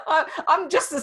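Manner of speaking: laughing through the words. Real, not acted. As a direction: chuckle while you talk. Laughing at her own joke.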